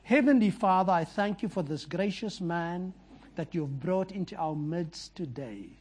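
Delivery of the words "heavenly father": ordinary talk, nothing marked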